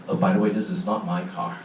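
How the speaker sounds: ordinary talk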